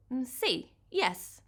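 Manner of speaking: hesitant Italian si; a little reluctant, Italian accent. Acted. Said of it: "Her accent was strong".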